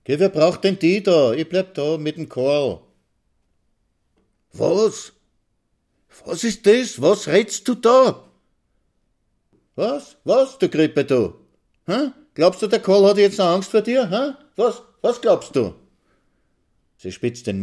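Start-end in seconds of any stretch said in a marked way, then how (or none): 0.0-2.8 high, loud, dismissive wife; scornful rejection. A narrator's reading. Wife scornfully rejects her husband. 4.5-15.8 high, loud, incredulous outburst; outraged challenge. A narrator's reading. Husband challenges wife in a quarrel.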